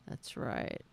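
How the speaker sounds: breathy whisper, fading away. Pretend. Streamer fades the phrase to a whisper. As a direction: let it die to a whisper.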